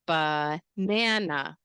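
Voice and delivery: very slow "banana", broken as ba-NAN-a; middle-syllable stress. Shown as a demonstration. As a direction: lean long on the middle of "banana". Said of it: "the stress is in the middle syllable".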